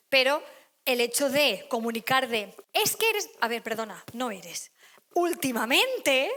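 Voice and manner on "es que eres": accusing. Retold or imitated. Acted accusation to partner.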